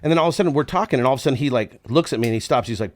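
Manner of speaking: usual speaking voice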